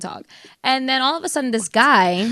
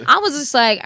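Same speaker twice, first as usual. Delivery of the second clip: ordinary delivery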